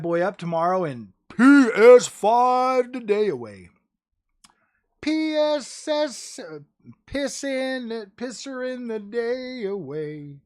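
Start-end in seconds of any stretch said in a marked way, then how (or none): 1.4-3.7 high, loud, stretched-out words; playful drawn-out slogan. Pretend. Streamer hyping viewers for tomorrow's unboxing, playful drawn-out call. 5.0-10.4 high, very slow, stretched syllables; playful drawn-out catchphrase. Pretend. Streamer trying out a silly catchphrase, drawn out high.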